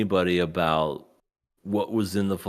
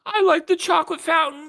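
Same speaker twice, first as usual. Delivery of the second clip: put-on very high, squeaky child voice; hammed-up kiddie falsetto; little kid impression; 5 dB louder than usual. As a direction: mimic a messy, gleeful small child. Imitates little kid at chocolate fountain.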